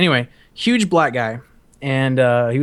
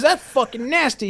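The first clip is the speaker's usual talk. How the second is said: high-pitched mock disgust; disgusted instructor; 10 semitones higher than usual. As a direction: imitate a superior's appalled verdict. Instructor's disgusted reaction retold.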